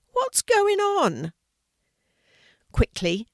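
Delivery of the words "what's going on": very high, drawn-out squeal; startled squeal. A narrator's reading. Hedgehog woken amongst the leaves, startled squeal. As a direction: squeal the question in alarm, slowly. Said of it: "squealed a startled voice".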